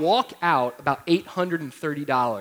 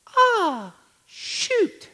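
put-on high, tiny toddler voice echoing the curse; sweet little girl repeating a swear; two-year-old daughter. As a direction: squeak it like a small child parroting dad. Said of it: "sweet voice".

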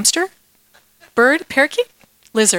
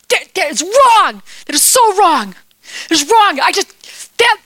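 loud, high outraged shout; King David bellowing in outrage at the theft; furious king; 8 semitones higher, 3 dB louder than usual. Acted. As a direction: rage at the injustice.

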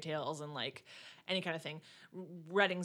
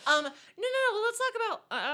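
high silly voice; 14 semitones higher, 6 dB louder, faster than usual. Acted.

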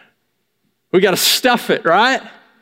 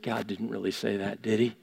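low, much softer, sly doubt planted in the ear; hushed tempting voice; 10 semitones lower, 16 dB quieter than usual. Retold or imitated. Enemy whispering doubt into your dreams, softly. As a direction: murmur it like the enemy sowing doubt.

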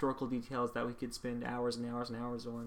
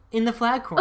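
much higher, louder hype; excited announcer; 9 semitones higher, 11 dB louder than usual. Acted. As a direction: hype the segment like a show. Segment jingle intro.

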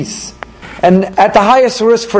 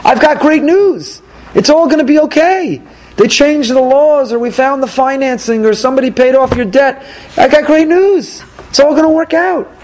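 higher-pitched, jubilant announcement; excited good news; 5 semitones higher than usual. Acted. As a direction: break the wonderful news, stretching it out. Acted caller announcing great news.

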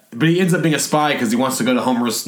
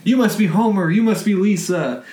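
put-on higher-pitched, eager-to-please kid; perfect kid; 6 semitones higher than usual. Perfect exchange kid greeting his host family, sweetly polite and high. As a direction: play the polite model child, pitched up. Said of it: "he's like the perfect kid".

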